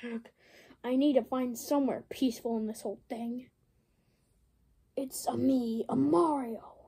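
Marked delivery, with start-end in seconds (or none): 5.0-6.7 hushed "It's a me, a Mario" in a light Italian lilt; Italian Mario accent. Acted. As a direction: slip into the cartoon Italian plumber. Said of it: "why did I say that?".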